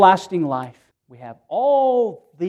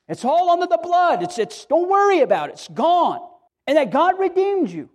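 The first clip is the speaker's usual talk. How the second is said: high, ringing preacher's cry; impassioned preaching; 7 semitones higher than usual. Acted. Pastor urging the saved to drop their guilt, impassioned. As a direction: lift the congregation with fervor.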